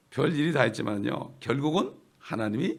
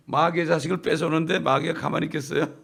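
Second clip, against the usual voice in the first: ordinary delivery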